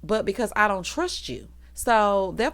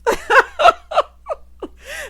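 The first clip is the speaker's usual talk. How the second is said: high, loud burst of laughter; loud cackle; 10 dB louder than usual. Real, not acted. Host cracking up at the drama, loud high cackle.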